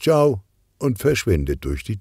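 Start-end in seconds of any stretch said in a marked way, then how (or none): 0.0-0.4 higher, lightly called goodbye. A narrator's reading. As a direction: toss out a quick raised farewell.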